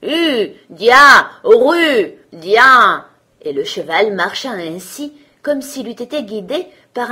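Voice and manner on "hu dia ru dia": loud carter's calls to a horse; shouted commands. A narrator's reading. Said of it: "lui cria le chemin qu'il fallait prendre".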